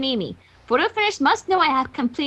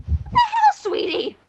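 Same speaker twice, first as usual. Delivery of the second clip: surprised; much slower than usual. Acted. Rarity reacts.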